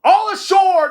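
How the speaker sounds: very loud, high call; sailor's loud call to land; shouted. A narrator's reading. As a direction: bellow it across the dock. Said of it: "the man shouted".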